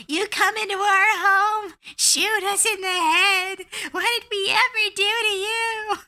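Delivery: very high, slow goblin voice pleading; a hurt little goblin asking why; high-pitched goblin reproach; wounded goblin. Retold or imitated. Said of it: "the goblin goes".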